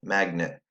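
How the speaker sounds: "magnet" as one word, stressed on "mag"; "magnet" ending in a schwa, like "nit"; syllables combined. Shown as a demonstration. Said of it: "Let's combine them".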